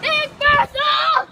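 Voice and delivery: slow, loud drawn-out wail; anguished wail. Pretend. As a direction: wail the name in despair. Wailing at gun letting him down.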